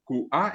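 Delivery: "Q A" split into ku and a; two syllables, "Q A", cut apart; glottal stop. Shown as a demonstration. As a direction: put a short catch inside "Q A". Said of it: "una pequeña diferencia en el acento".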